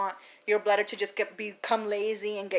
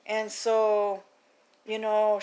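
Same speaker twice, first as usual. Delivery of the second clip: usual speaking voice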